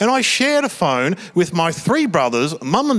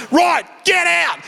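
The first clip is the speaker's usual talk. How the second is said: much higher, slow angry yell; furious dad shout; 11 semitones higher, slower than usual. Retold or imitated. Dad ordering Trevor out of the car, shouting. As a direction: bellow the order. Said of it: "dad kicks him out".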